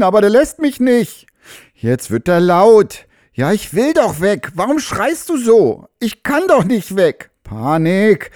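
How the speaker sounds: panicking horse. Acted. Horse at its first shoeing, panicking as it feels the burn.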